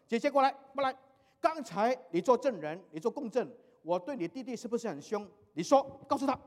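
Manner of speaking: 拔高嗓门大声喊人过来; 像急着找证人一样高声叫; 大声叫人作证. Retold or imitated. 父亲叫大女儿来作证. (叫姐姐过来作证，高声大喊)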